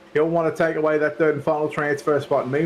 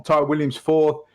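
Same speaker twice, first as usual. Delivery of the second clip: ordinary talk, nothing marked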